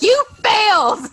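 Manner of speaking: high, loud mock verdict; triumphant verdict. Maury telling Mike he failed, mock verdict. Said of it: "He's like".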